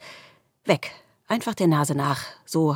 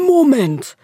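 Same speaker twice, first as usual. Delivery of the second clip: loud, higher, slow call; 9 semitones higher, 8 dB louder than usual. A narrator's reading. The darkness calls out to the squirrel from far back.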